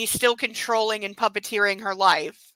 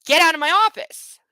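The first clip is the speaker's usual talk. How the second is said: imagined telling off; 6 semitones higher, 6 dB louder than usual. Retold or imitated. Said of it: "Like, I, I'd be telling him".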